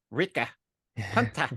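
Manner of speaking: light imitation of the girl's odd New Zealand-ish accent; Omega's accent. Host mimics how Omega calls her squad, mock accent. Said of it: "when Omega says it".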